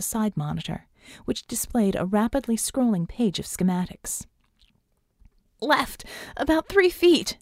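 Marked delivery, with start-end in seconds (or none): 5.6-7.4 slow, high-pitched yell; high, drawn-out shout; yelled direction. A narrator's reading. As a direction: call it out urgently across the room. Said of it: "she yelled".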